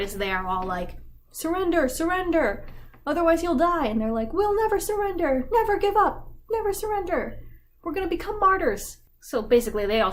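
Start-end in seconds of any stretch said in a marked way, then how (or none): 1.3-3.9 higher, louder ordering voice; high mock call to surrender. National Guard call to the students, a higher, louder hammed-up command. 4.3-9.0 much higher, louder, cartoonish student voice; high mock heroic defiance. Students defy the National Guard in a much higher hammed-up heroic voice.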